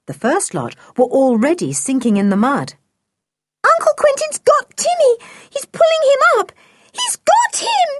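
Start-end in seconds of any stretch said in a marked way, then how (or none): 3.7-7.9 fast, very high-pitched cry; excited squeal. A narrator's reading. Anne sees the dog being rescued, excited squeal. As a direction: squeal it out, climbing higher. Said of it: "squealed Anne".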